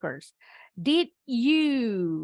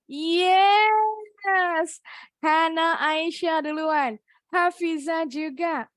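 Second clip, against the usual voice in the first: loud, high, cheering announcement; excited announcing; 8 semitones higher, 5 dB louder, much slower than usual. Real, not acted. Teacher excitedly names the students who answered first. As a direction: celebrate the winners out loud.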